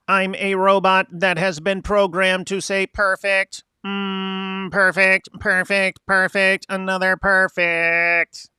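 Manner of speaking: booming metallic robot, slow and high. Shown as a demonstration. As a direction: stiff mechanical robot, drawn out. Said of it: "please say this with a robot voice".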